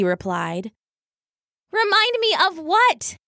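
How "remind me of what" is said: high-pitched, exasperated little weasel; cross question. A narrator's reading. Weasel sister challenging her brother's reason, exasperated. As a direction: demand an answer in a high voice.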